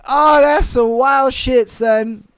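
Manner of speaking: ordinary talk, nothing marked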